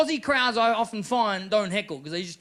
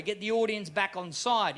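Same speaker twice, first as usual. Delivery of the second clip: usual speaking voice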